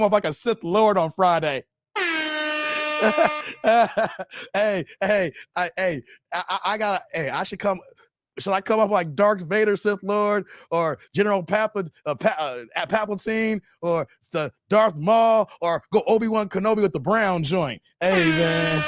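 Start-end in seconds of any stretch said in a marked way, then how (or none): none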